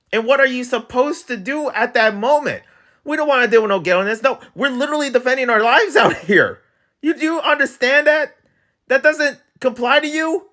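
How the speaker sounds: high-pitched exasperated protest; exasperated rant. Real, not acted. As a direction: plead the case in indignant disbelief. Ranting about robbed shop owners' self-defense.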